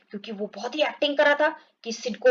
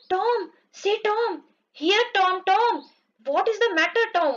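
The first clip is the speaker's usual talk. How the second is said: worried calling; 7 semitones higher, much slower than usual. Acted. Sid alarmed, calling Tom.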